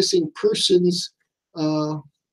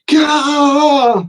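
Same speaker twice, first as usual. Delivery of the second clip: sudden high, loud startled cry; a loud gasping shriek of fright; startled yelp; 9 semitones higher, 6 dB louder than usual. Retold or imitated. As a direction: jump out of your skin with a yelp. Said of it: "He goes".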